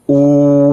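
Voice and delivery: drawn-out ऊ with effort; tense long vowel. Shown as a demonstration. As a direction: sustain ऊ from belly and throat. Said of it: "पेट आ गया और गला भी".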